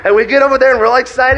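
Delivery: high, loud, breathless-with-joy retelling; excited. Retold or imitated. Player recalling running over to coach, excited. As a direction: carry the celebration into the telling. Telling while still excited.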